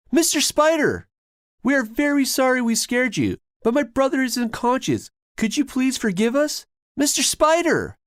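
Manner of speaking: high, loud pleading call. Acted. Brothers calling for the spider, pleading. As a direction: call to it, begging.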